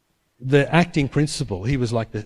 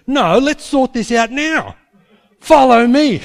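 much higher and louder, slow and firm on "now"; commanding call; 10 semitones higher, 8 dB louder, slower than usual. Retold or imitated. Acting principal ordering the chaplain to follow him, loud command. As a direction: take charge and order them along.

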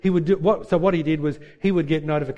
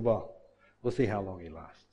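low, quiet scoff; dismissive doubt; 8 semitones lower, 7 dB quieter than usual. Retold or imitated. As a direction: shrug him off under your breath. Rival runners dismissing Cliff.

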